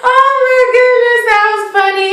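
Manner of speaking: high sigh of tickled relief; amused after laughing. Real, not acted. Recapper gets over a laughing fit, high and amused.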